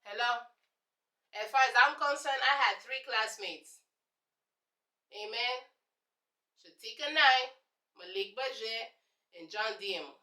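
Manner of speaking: ordinary talk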